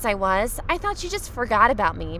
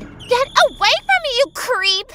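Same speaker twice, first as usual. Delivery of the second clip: much higher, louder shriek; screaming; 14 semitones higher, 6 dB louder than usual. Acted. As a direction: scream at him in disgust. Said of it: "she screamed as loud as she could".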